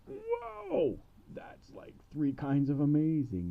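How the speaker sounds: high, loud gasp of wonder; amazed king. Retold or imitated. King marvels at the gold, loud and high. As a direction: blown away by the gold.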